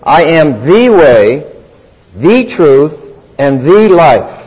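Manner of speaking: slow, "the" pressed before way, truth and life; stress on each the. Shown as a demonstration. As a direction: hold back the pace and hit every "the". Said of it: "there's an article with each one of these".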